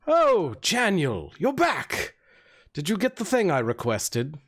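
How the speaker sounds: high, swooping bard voice; bard's greeting. Acted. Bard greets the returning orc, high and swooping. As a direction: greet him in a high lilt, easing down.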